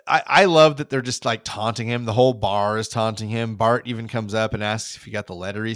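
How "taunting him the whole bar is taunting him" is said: usual speaking voice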